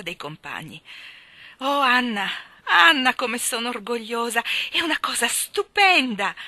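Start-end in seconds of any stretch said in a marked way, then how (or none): 1.6-6.3 high, loud gushing pride; overjoyed. A narrator's reading. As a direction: burst with pride for your best friend. Jane overjoyed at friend's win.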